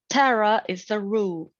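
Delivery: ordinary delivery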